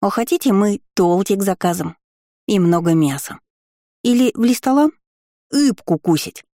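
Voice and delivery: put-on eager baby-talk gargoyle; lisping little creature. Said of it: "жизнерадостно позвала Горгулья".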